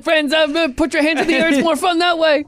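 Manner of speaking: high, loud theme-park announcer; ride operator voice. Pretend. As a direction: hype up the riders like a ride host. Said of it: "terrible impression of a roller coaster".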